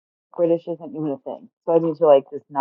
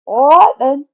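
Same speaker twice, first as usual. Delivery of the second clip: much higher, much louder English echo; echoed English accent; 10 semitones higher, 10 dB louder, slower than usual. Retold or imitated. Interviewer echoing the English guy, exaggerated English accent. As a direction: parrot the Englishman back in a big mock accent. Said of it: "And I said".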